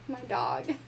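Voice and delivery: plain reading voice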